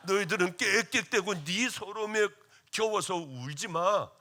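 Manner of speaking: stern scolding shout. Retold or imitated. Pastor retells scolding young ministers about crying, loud stern shout. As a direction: bark it like a tough preacher scolding. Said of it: "설교하면서 울거나 하는 깨깨대는 놈들은".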